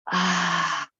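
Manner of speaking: long open "ah" pushed out on the exhale; forceful sigh of ah. Shown as a demonstration. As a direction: let the breath go in one audible sigh. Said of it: "exhale out of your mouth with a sigh of ah".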